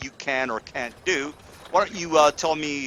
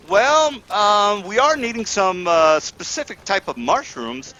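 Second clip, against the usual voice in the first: higher, louder lady's voice; wife's voice; 8 semitones higher, 6 dB louder than usual. Acted. Wife asking the party for rare mushrooms, a higher, louder lady's voice. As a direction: ask politely but eagerly.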